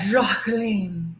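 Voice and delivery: Jacqueline drawn out with a soft French J, "zhak-LEEN"; affected pronunciation. Pretend. Greeting viewers by name, Jacqueline in affected French pronunciation. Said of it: "I just have to say it like that".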